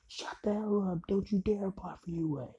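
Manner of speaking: mock angry retort. Bakugo warns the boy off applying, mock angry. Bakugo angrily cuts him off.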